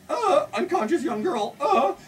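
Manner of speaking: high-pitched alarmed cab driver; comic fright on finding someone passed out; mock alarm. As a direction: play a startled man, voice jumping up high. Panicked cab driver quoted on finding her.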